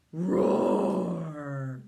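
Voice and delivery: big loud roar; dragon roar. Pretend. Parent leads child in a loud dragon roar.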